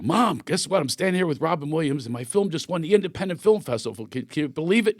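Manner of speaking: high, eager news for mom; bursting to tell mom he won; excited. Retold or imitated. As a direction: son thrilled on the phone with his mom. Excitedly calling his mom.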